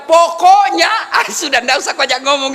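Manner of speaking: nagging wife. Retold or imitated. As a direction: bark it like a wife ending the argument. Wife's nagging final word quoted. (tiru istri yang ngotot, lebih tinggi dan keras)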